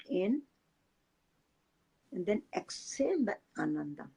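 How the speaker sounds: soft, unhurried meditation guide voice; guided meditation. Acted. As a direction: ease the listeners into calm breathing.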